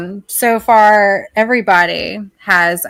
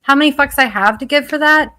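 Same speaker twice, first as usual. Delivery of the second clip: higher, louder exasperated rant; fed-up outburst, pitched up; 6 semitones higher, 4 dB louder than usual. Real, not acted. Ranting at friend's request.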